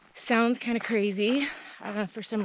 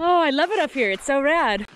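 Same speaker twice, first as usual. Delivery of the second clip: higher and louder, bubbling over; excited; 5 semitones higher, 4 dB louder than usual. Real, not acted. Hiker on top of Eagle Rock, gushing about the view excitedly. Thrilled at summit.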